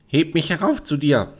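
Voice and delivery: high-pitched demanding frog; frog calling out. Acted. Frog at her chair demanding a lift, calling. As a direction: call up to be lifted. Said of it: "Da saß er und rief".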